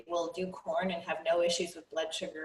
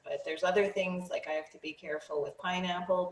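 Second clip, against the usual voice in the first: ordinary talk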